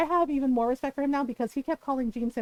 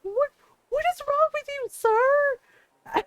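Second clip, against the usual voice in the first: very high, squeaky what is wrong with you sir; shocked indignation; 12 semitones higher, 3 dB louder, much slower than usual. Retold or imitated. Host confronting the creep in a very high disbelieving voice. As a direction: shriek it in mock outrage.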